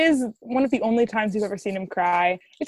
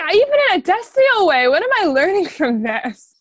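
mock exasperation; 7 semitones higher, 6 dB louder than usual. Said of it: "I was like".

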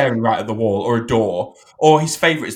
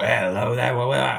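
put-on New York accent; 2 semitones lower than usual. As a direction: lightly mimic a New York movie baddie. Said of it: "It's like a bit of New York".

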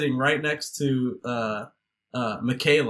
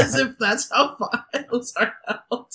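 laughing; 10 semitones higher than usual. Real, not acted. Laughing at absurd film scene.

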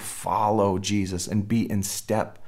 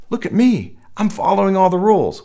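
much higher, louder and fast, preening; mock smug boast; 10 semitones higher, 6 dB louder than usual. Preacher mimics a prideful believer bragging, hammed-up and much higher. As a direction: puff up like a proud rule keeper. Mocking self-satisfied rule keeper.

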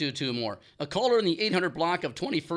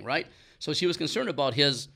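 ordinary delivery

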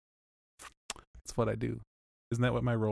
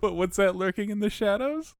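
spooky teaser; 11 semitones higher, 4 dB louder than usual. Acted. Spooky teaser about lurking figure.

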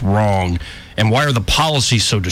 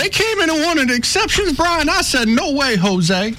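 put-on much higher old Southern lady voice; old lady impression. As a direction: sass it like a feisty old governor. Impersonating the governor.

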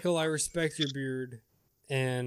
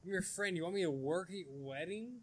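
softer, incredulous quoting; mock friend quote; 2 semitones higher, 10 dB quieter, faster than usual. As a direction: retell the friend's ask, hushed. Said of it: "it's like".

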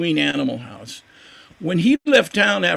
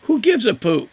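scornful thought; 6 semitones higher than usual. Retold or imitated. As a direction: toss off "who gives a poop?" with disdain. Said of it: "And I think".

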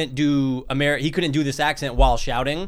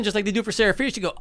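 ordinary talk

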